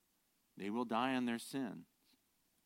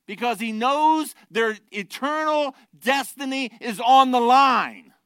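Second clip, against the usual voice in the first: loud, high challenge, slowing at the end; full-force preaching; 13 semitones higher, 13 dB louder than usual. Acted. Pastor challenging the church's hope, loud preaching. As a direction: press each question on the listeners.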